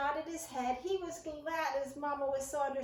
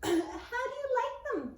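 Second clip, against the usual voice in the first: high, slow mouse voice; a small mouse asking sweetly; Ced's voice; 10 semitones higher, 4 dB louder, slower than usual. A narrator's reading. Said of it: "said Ced".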